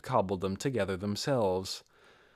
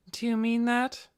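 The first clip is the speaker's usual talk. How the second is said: much higher, light female voice, questioning; questioning maid voice; 11 semitones higher than usual. A narrator's reading. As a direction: ask it as the cool female servant. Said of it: "Narbrill's questioning voice".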